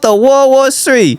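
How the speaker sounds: put-on high, drawn-out Chinese-accented protest; hammed-up Chinese accent.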